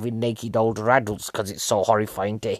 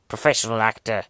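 usual speaking voice

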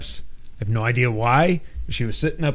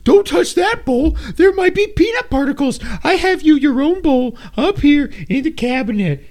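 high shrill mom voice, much higher and louder; frantic overprotective mother, pitched way up; man doing a woman's voice; 12 semitones higher, 5 dB louder, slower than usual. Retold or imitated. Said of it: "But then Mom's like".

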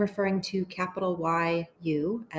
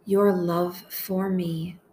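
slow, measured meditation voice with long pauses; unhurried, deliberate phrases for listeners to echo; guided prayer meditation; slower than usual. Acted. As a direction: pray each short phrase slowly, leaving space to repeat it.

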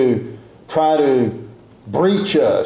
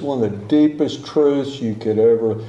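normal talking voice

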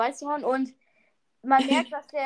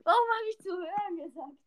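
laughing through the words; giggling, barely getting it out; stifled giggles; 11 semitones higher, faster than usual. Real, not acted. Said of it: "Hör auf zu lachen".

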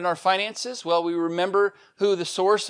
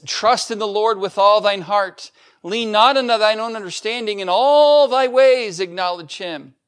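louder, higher solemn scripture reading; 5 semitones higher, 6 dB louder than usual. Acted. Pastor reading Proverbs to the congregation, solemn and louder. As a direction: proclaim the verse to the church.

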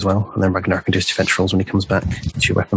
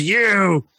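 high, drawn-out snarling challenge; angry enemy's snarl, words stretched out; 8 semitones higher, much slower than usual. Acted. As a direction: snarl it slowly at a foe, pitched up. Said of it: "he sort of snarls at you and goes".

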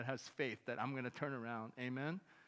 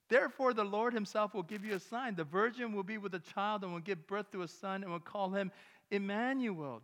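louder, higher reading of Isaiah; scripture proclamation; 5 semitones higher, 7 dB louder than usual. Acted. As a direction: proclaim the prophecy to the church.

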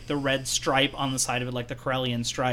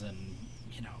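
secretive whisper; conspiratorial spy whisper; 7 semitones lower, 16 dB quieter than usual. Retold or imitated.

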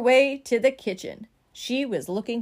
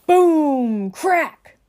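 loud, dramatic crash words; big storybook sound effect; booming sound words; 4 semitones higher, 7 dB louder than usual. A narrator's reading. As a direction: burst out like a sudden crash.

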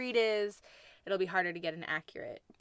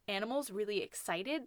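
usual speaking voice

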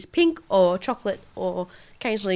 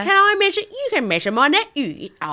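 higher-pitched mock Leeds accent; 8 semitones higher, 4 dB louder than usual. Leeds customer answering the shop assistant, mock Leeds accent. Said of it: "do the accent".

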